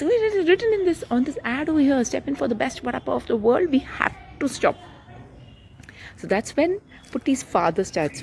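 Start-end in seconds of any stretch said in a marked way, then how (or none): 0.0-4.8 put-on high, fast pleading kid voice; a boy rushing to convince his parents; eager child. A narrator's reading. Putti urging Ma to stop the car, high and fast. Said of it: "so he says".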